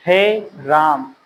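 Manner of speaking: हे stretched long and slow before राम; drawn-out हे. Shown as a demonstration. Teacher shows the class how the prolonged हे sounds. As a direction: hold the vowel of हे, then राम. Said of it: "तो हे को क्या करते हैं? खींच लेते हैं।".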